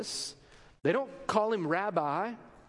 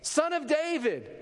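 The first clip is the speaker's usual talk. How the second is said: higher, drawn-out mock yell; imitated yell; 6 semitones higher, slower than usual. Blind men hailing Jesus as he passes, imitated yell. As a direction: call out slowly, pitched up, without full volume. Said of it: "yell as loud as they can".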